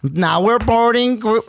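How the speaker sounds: slow, high boarding call; gate announcer. Retold or imitated. Imitating airline boarding call.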